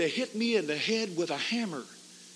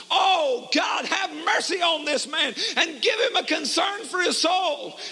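fervent prayer; 8 semitones higher than usual. Retold or imitated. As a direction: cry out to God for mercy. Prayer cried aloud.

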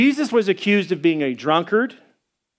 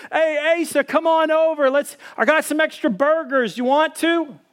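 much higher, cheery call across the yard; friendly call; 9 semitones higher than usual. Retold or imitated. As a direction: invite the neighbor over brightly. Calling neighbor over to barbecue.

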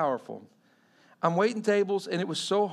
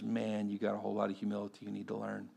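quiet rebuke; 8 semitones lower, 8 dB quieter than usual. Retold or imitated. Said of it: "The Lord looks at you and goes".

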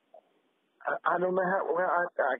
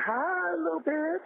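high-pitched, put-on hello; high, affected drawn-out greeting; 10 semitones higher than usual. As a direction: imitate his affectation, pitched way up. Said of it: "in that affectation".

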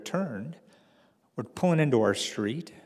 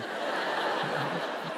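real laugh; 5 semitones higher than usual. Real, not acted. Teller to the audience about the cop, a real chuckle. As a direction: laugh at your own absurd story.

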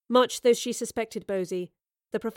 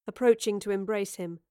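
plain reading voice

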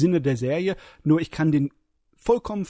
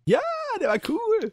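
excited high. Real, not acted. Enthusing high.

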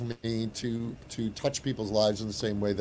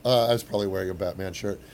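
ordinary delivery

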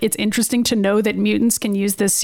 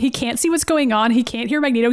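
normal talking voice

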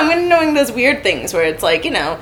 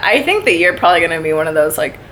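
usual speaking voice

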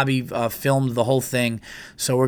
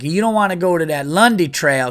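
higher, louder southern twang; mock southern accent; 5 semitones higher, 5 dB louder than usual. Local woman warning hikers off a trail, mock southern accent. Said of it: "keep doing a southern accent".

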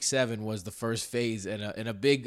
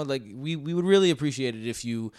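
ordinary delivery

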